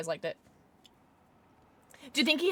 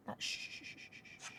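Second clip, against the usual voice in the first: breathy unvoiced shushing; hushing; 23 dB quieter, much faster than usual. Real, not acted. Shushing the chess piece to be quiet. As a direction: hush it down to nothing.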